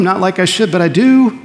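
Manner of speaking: a light hint of a shy confessing believer; humble confession. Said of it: "Do you love him?".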